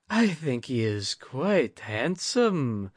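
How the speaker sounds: a hint of a high, slow lady's voice; lightly voiced lady guest. A narrator's reading. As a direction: lightly play the noble guest. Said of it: "said the guest".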